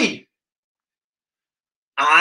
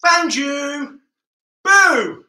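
playful shout; 7 semitones higher, much slower than usual. Pretend. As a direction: pounce on the kids with a shout. Seeker catches kids.

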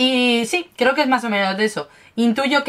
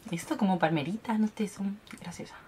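whisper; 11 dB quieter than usual. Real, not acted. Quiet aside while unboxing.

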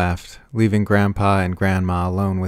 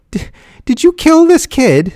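very high, loud, stammered question; aghast, catching her breath; breathless horror; 10 dB louder than usual. A narrator's reading. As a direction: struggle to get the accusation out. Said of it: "struggling to catch her breath".

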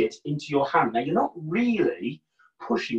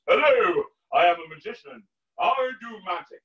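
louder, grand showman's greeting; theatrical magician; 5 semitones higher, 3 dB louder than usual. Shown as a demonstration. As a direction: announce it like a stage magician. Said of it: "That's my magician's voice".

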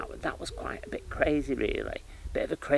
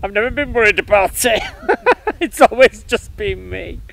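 higher and louder, laughing mid-line; a cheeky kid brushing it off; laughing child's boast; 11 semitones higher, 13 dB louder than usual. Retold or imitated. Seven-year-old tells priest, laughing and carefree.

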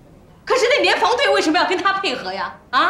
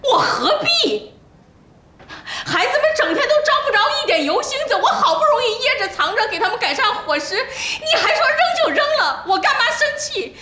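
tearful outburst; 5 semitones higher than usual. Acted. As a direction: let the grievance spill out in sobs. Fish thrown away, wife breaks down.

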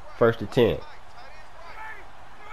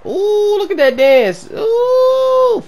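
very high, loud hoots; gleeful whooping; 7 dB louder, much slower than usual. Real, not acted. Gamer admiring his touchdown dance, gleeful whooping. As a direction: whoop over the celebration.

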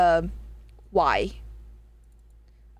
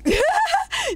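high burst of laughter. Real, not acted. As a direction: explode into shrieking laughter.

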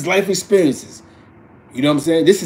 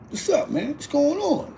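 concerned dad; 12 semitones higher, 5 dB quieter than usual. Retold or imitated. Dad answering daughter's phone call.

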